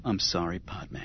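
soft, low apology; a soft, sorry murmur; said softly. Acted. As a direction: say it gently and quietly. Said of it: "He said softly".

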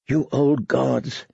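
slow, soft prayer. Acted. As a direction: pray softly and slowly. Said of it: "she prayed".

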